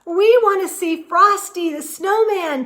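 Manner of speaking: put-on high, loud eager kids' shout; two girls shouting to their parents. A narrator's reading. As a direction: call out like excited little girls. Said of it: "two girls shouted".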